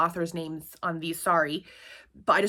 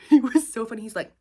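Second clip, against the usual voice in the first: ordinary talk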